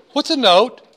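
ordinary talk

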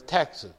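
Texas squeezed into one syllable, Texan style; Texan pronunciation. Shown as a demonstration. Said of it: "One word, one syllable".

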